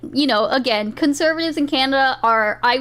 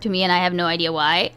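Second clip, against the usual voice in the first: normal talking voice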